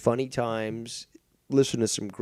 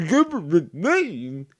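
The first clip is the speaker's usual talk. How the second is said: slow, higher, louder mocking echo of the phrase; mocking repeat; 7 semitones higher, 8 dB louder, slower than usual. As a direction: drag it out in scorn. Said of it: "his government name?".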